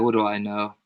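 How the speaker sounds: ordinary talk, nothing marked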